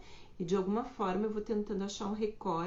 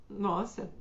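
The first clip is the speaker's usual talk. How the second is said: ordinary talk, nothing marked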